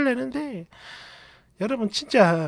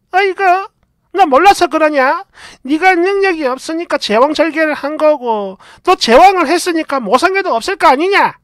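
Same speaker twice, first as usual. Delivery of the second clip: mother-in-law; 5 semitones higher, 5 dB louder than usual. Retold or imitated. Mother-in-law scolding, voiced in story.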